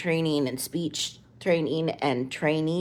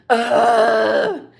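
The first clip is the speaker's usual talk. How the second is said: loud, high exasperated "ugh" thrown back; mimicked groan; 6 semitones higher, 9 dB louder than usual. Mom mimicking her son's groan back at him. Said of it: "when I just mimicked him perfectly".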